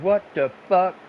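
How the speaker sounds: a loud, high, stunned what the fuck; shocked outburst. Retold or imitated. Woman shown her own security tape, loud shocked outburst. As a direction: blurt it out in disbelief.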